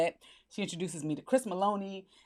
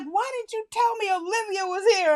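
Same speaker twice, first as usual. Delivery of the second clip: higher, aggrieved complaint; imitating a disappointed boss, pitched up; mock whiny boss; 10 semitones higher than usual. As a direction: whine it like the boss who missed out. Boss upset at missing her.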